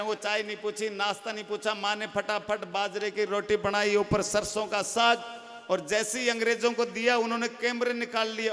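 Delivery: ordinary talk, nothing marked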